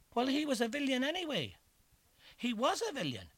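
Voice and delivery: high, fast indignant jeer; indignant listener. Acted. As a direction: blurt it out indignantly. Listener's outburst voiced.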